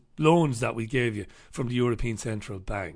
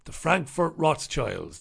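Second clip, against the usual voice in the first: ordinary talk, nothing marked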